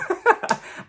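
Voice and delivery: high, quick laugh; cracking up at her own joke; high burst of laughter. Real, not acted. Vlogger laughing at her own joke, high laugh.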